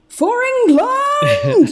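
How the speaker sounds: high, long-drawn mock battle cry; hammed-up rousing cry before a charge; Brian Blessed. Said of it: "and then he says".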